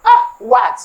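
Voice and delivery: high startled what; mock surprise. A friend begs for help on the road, a high startled what. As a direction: react as if caught off guard. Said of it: "You say".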